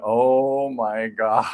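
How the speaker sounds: low, drawn-out retold shock; stunned disbelief. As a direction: let it out slowly, a touch aghast.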